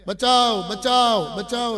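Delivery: loud cries of help from the well, first two the loudest; shouting for help. Acted. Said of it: "રાડો દેતા હતા". (મદદ માટે મોટેથી બૂમો)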